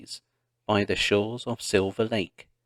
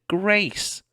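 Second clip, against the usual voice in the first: screaming; 10 semitones higher than usual. A narrator's reading. Laura screaming for lost Grace on the hill. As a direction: scream for her sister. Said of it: "she screamed".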